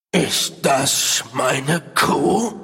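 growling. Acted. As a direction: growl it out like a beast. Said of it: "knurrte er".

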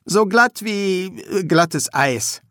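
high, slow, halting attempt to sound fine; uneasy, stretched-out hesitant reply; struggling for composure. A narrator's reading. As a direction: feign ease while groping for words. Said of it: "versuchte, sich wieder unter Kontrolle zu bringen".

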